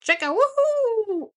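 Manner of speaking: high, cheerful little bird cheer; chirpy bird voice. Acted. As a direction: chirp it out like a tiny chickadee. Chickadee character cheers.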